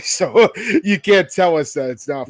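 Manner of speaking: loud laughing line. Real, not acted. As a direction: laugh while saying it. Laughing remark.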